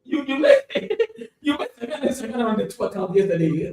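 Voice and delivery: laughing. Real, not acted. Laughing at lost profit.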